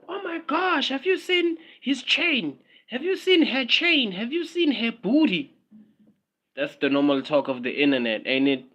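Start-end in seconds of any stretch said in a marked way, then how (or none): 0.1-5.5 mock gossip voice. Vlogger mocking the normal talk of the internet, high gushing. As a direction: gush like a starstruck fan.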